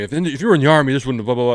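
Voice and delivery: angry teacher. Retold or imitated. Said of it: "he kept saying".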